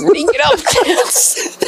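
put-on very high, drawn-out impish kid voice, laughing; elf-dancing kid. Boy leaping from top bunk, imitated high silly chant. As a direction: squeal it slowly like a gnome through giggles. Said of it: "and he goes".

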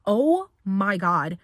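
loud, drawn-out shock; shocked reaction. Retold or imitated. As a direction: stretch it out, stunned by the blast. Reaction to tobacco hitting nose.

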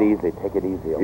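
calming. Acted. Eaton calming his lieutenant in the standoff, soft and steady. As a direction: settle everyone down gently.

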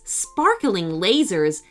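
plain reading voice

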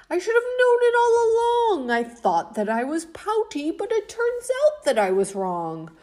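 cheerful fish singsong; joyful kiss-kiss fish. Acted. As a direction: burst out with happy surprise. Fish overjoyed, new refrain.

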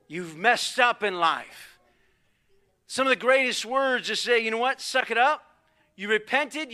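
loud, high, driving sermon delivery; fired-up preacher urging repentance; full-force preaching. Real, not acted. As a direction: preach it hard, hammering each demand.

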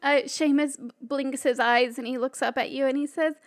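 ordinary talk

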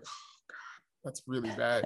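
whispered groan of disapproval; hushed dismay. Real, not acted. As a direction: sigh it out very softly, almost whispering. Mock dismay at the cartoons.

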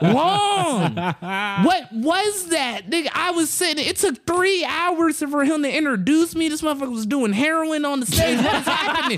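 high-pitched incredulous rant; mock outraged roast. Comic going in on the host's long intro, hammed-up outrage. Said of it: "started going in on 'em".

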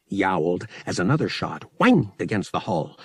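ordinary delivery

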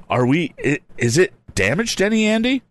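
put-on high, worried boy's voice; nervous sidekick, voice climbing high; anxious crony. A narrator's reading. Timid crony voiced in story.

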